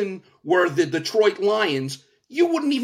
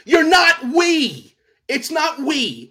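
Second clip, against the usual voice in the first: raised, higher, heated rebuttal; heated pundit debate; 7 semitones higher, 4 dB louder than usual. Real, not acted. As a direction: push back hard and loud. On-air argument over "we.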